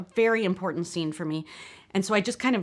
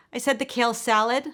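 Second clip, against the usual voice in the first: louder, higher insistent repeat; exasperated, pitched-up repetition of the order; 6 semitones higher, 5 dB louder than usual. Acted. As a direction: raise your voice to be heard. Repeats order to deaf server.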